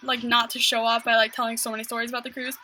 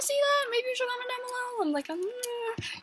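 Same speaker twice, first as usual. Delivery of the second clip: hint shyly in a high little singsong; much higher and softer, stretching into an awkward "um"; coy high singsong; 10 semitones higher, 7 dB quieter than usual. Real, not acted. Bashful, teasing plea for comments.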